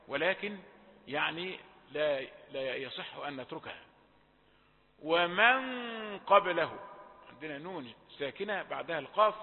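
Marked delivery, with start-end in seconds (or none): 5.0-6.8 long dark nasal hum in "ومن" before qaf; heavy ghunnah. Shown as a demonstration. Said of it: "فتيجي الغنة مفخمة". (غنة مفخمة في "ومن" قبل القاف)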